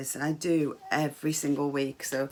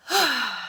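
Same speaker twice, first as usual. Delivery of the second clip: loud, high sigh of relief; big satisfied exhale; 8 semitones higher, 8 dB louder than usual. Shown as a demonstration. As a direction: sigh it out with pleasure. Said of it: "lovely big sigh as we relax".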